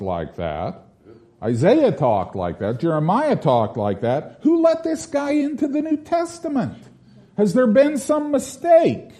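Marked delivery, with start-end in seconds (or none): 4.4-9.1 high-pitched incredulous protest; strained high, disbelieving question; mock indignation. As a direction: object like a baffled complainer. Mock objection to James's manner.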